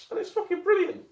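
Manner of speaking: high delighted squeal; excited squeal. Real, not acted. Delighted by new torch.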